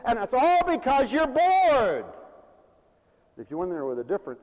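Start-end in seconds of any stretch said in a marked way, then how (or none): 0.0-2.0 high, loud, slow punchline; raised pulpit exclamation; emphatic preaching. Real, not acted. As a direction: drive it home, stretching the final word. Preacher lands the point.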